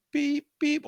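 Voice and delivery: high, short monitor beeps; imitated machine beeps. Describing his friend in the ICU, high imitated beeps.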